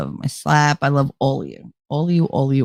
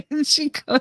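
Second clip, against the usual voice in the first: laughing; 7 semitones higher, 6 dB quieter than usual. Real, not acted. Laughing at the joke.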